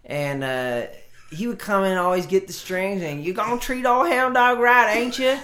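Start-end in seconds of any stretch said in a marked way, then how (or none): 3.2-5.4 high, very loud Southern twang; Hound Dog's Southern accent. Retold or imitated. Guitar shop worker mimicking customer getting strings, Southern accent. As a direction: imitate a loud Southern character, pitched way up. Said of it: "he'd talk with a Southern accent".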